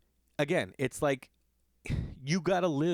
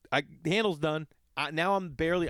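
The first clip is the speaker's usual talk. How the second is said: normal talking voice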